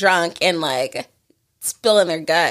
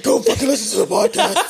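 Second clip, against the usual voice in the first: higher-pitched hammed-up drunken insistence; exaggerated, pushy mock outburst; mock aggressive; 7 semitones higher than usual. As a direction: imitate a friend aggressively making a demand. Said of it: "I can only imagine how aggressively you're saying this".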